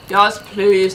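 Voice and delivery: plain reading voice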